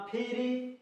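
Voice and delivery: फ्री as a drawn-out "fee-ree" for fire; wrong reading of fire. Shown as a demonstration. Teacher shows how not to read fire, mistaken फ्री. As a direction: misread fire with long "ee" vowels, as फ्री. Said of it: "ऐसे नहीं पढ़ना है".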